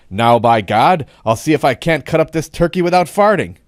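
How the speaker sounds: ringing punchline announced to a whole room; loud and distinct. A narrator's reading. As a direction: declare it boldly, hitting every word. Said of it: "said loudly and distinctly".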